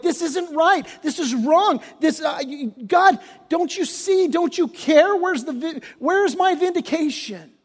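high, pleading cry to God, rising in pitch. Acted. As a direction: cry out to God in anguish, as if unheard. Crying out to God.

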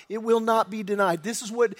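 normal talking voice

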